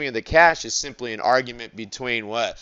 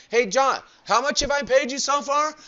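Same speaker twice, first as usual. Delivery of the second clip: a higher-voiced caller asking about his balance; client calling up; 13 semitones higher, 3 dB quieter than usual. Customer phones the accountant asking what he's paid, lightly voiced. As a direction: play the customer on the line, pitched up.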